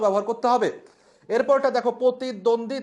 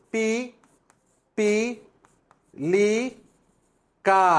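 পি, পি, লি, কা with long pauses; syllable by syllable; slower than usual. Shown as a demonstration. Teacher spells the word slowly, one syllable at a time. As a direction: break পিপিলিকা into single syllables. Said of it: "স্পেলিংটা হবে".

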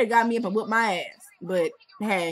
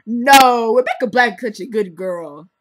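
loud mock insistence, louder; mock parent insisting; 9 dB louder than usual. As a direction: defend her like a stubborn parent. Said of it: "they're like".

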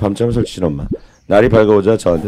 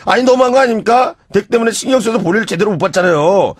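indignant complaint; 10 semitones higher than usual. Retold or imitated. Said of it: "저도 화가 나서 ... 따졌습니다".

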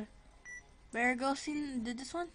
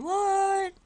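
surprised; 8 semitones higher, 6 dB louder than usual. Real, not acted. Sudden surprise in game.

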